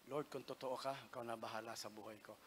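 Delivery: softly praying under the breath; whispered prayer. Retold or imitated. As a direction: hush it like a secret prayer to God.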